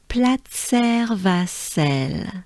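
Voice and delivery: Platzer Vassel drawn out slowly in French; slow French pronunciation. Shown as a demonstration. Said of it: "So let's do it together".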